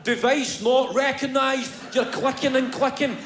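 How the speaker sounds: usual speaking voice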